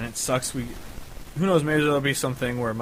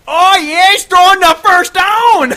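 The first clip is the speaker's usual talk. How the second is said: put-on much higher, much louder Wisconsin accent yell; Wisconsin fan; 16 dB louder, slower than usual. Imitating fans heard behind her at the game: Wisconsin accent yell. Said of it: "two Wisconsinites behind us".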